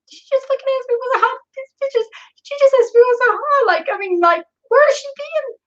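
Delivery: squeaky, high-pitched disbelief; high incredulous inner rant. Retold or imitated. To herself about the attendant, high incredulous disbelief. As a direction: shriek it in your head, stunned. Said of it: "my mind was going in like".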